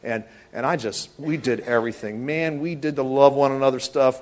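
ordinary talk, nothing marked

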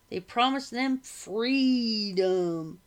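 freedom drawn out slowly and loud; stretched-out word. A narrator's reading. Shovel man sneering at the British promise, freedom stretched out. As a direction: drag out freedom until it sounds ugly. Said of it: "He stretched out the word until it sounded ugly".

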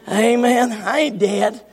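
ordinary talk, nothing marked

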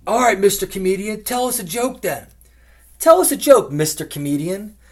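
heckling from crowd. Retold or imitated. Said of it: "he goes".

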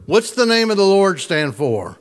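ordinary talk

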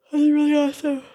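said through a yawn. Real, not acted. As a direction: stretch the words into a yawn. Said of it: "I'm yawning".

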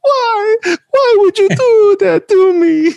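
very high, whiny mock-Italian wail, laughing through it; hammed-up Italian accent, very high and tearful; exaggerated Italian accent. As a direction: imitate a hurt Italian cousin, way overdone. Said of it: "does that thing at the fashion show".

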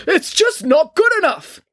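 high, loud, drawn-out yell; furious shout carrying down a corridor; angry yelling. A narrator's reading. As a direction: bellow it in outrage. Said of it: "they could hear yelling".

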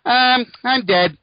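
high, loud, slow old mayor impression; loud nasal New York mayor; Ed Koch. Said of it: "that's my Ed Koch".

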